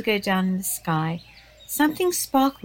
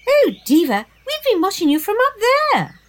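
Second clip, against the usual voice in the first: put-on teasing kid voice; 13 semitones higher, 6 dB louder than usual. Maisie teasing Diva.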